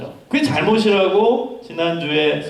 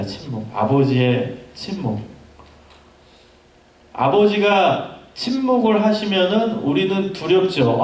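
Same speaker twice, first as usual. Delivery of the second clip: normal talking voice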